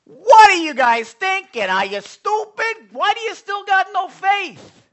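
rough Italian-style scolding. Retold or imitated. Jesus scolding disciples as the young preacher imagined, loud exasperated. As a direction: berate them like an impatient Italian. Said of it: "So I'm thinking he's saying".

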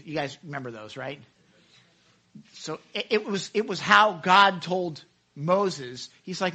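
ordinary talk, nothing marked

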